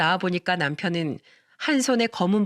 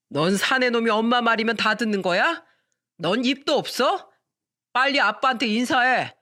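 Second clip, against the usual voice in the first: put-on drunk father scolding; 3 semitones higher than usual.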